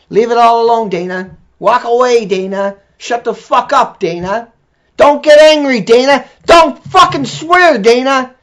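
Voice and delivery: high, loud, slow mock scolding that builds. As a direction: parrot the critics in a shrill hammed-up rebuke. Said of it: "mocking critics scolding him".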